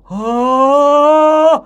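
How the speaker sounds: high, swelling sound kept back; badly projected singing "아"; held-in singer's call. Shown as a demonstration. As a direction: sing out without handing it over. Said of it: "노래할 때는 이런 소리".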